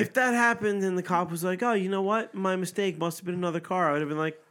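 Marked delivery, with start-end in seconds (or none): 1.6-3.9 lightly voiced cop, pitched up; apologetic cop. Podcaster imagining how the cop should have answered, apologetic. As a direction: play a cop owning up, higher than usual.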